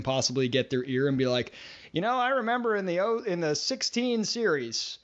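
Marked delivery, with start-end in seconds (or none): 1.9-5.0 put-on lightly higher, rambling old fan; a hint of a nostalgic old-timer boring strangers; old man reminiscing. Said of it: "you're gonna be that guy".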